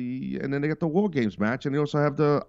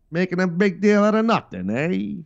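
put-on higher, louder old-movie gangster with cigar; old gangster movie guy; 6 semitones higher, 4 dB louder than usual. Mocking critics as an old gangster movie tough guy, higher and louder. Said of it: "like one of those guys from the old gangster movies".

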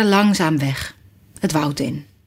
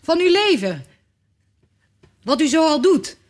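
calling out; 7 semitones higher than usual. A narrator's reading. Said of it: "riep ik".

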